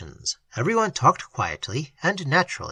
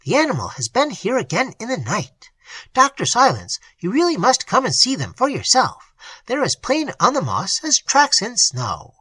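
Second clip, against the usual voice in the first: excited; 7 semitones higher than usual. A narrator's reading. Sangre rushes in to tell of footmarks, excited. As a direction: burst in with the news. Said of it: "he said with excitement".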